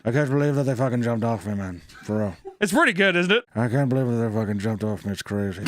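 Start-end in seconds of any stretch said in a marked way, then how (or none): 0.0-2.4 mild put-on Irish lilt; lightly imitating an Irish friend; Jono's Irish accent. Host imitates Irish friend Jono's talk, light Irish accent. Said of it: "he kinda sounds like this". 3.5-5.6 easy Irish lilt, lightly done; Jono's Irish accent. Retold or imitated. Host does friend Jono's reaction again, mild Irish accent. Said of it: "I got the Jono accent".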